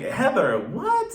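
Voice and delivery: shocked, disbelieving call-out of her name; stunned mock reaction; incredulous what. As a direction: voice jumps high on what, in disbelief. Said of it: "And I'm like".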